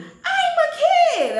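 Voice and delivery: high, loud, slow laughing outcry; squealing indignation; indignant protest. Retold or imitated. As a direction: cry it out in disbelief. Protesting she is a child.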